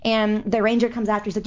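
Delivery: ordinary delivery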